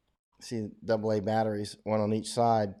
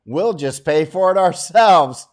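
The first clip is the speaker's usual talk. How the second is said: hammed-up defiance, swelling at the end; mock defiant complainers; 4 semitones higher, 8 dB louder than usual. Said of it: "all you complainers ... Then you can say".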